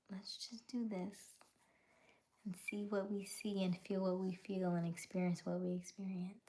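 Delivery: soft breathy whisper. Real, not acted. Whispering an invitation to viewers to experience it. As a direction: hush it like a secret.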